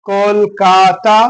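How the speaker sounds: "Kolkata" slowly as কল, কা, তা; broken into syllables. Shown as a demonstration. Teacher counting three syllables for pupils, "Kolkata" slowly broken up. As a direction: stretch "Kolkata" into three separate beats. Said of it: "এটা হলো তিনখানা".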